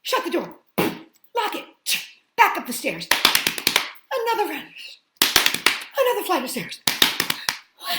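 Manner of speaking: high, loud, breathless reading of the chase; excited storybook reading. A narrator's reading. Reading the bear hunt ending to children, loud and breathless. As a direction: read the escape loud and urgent, building tension.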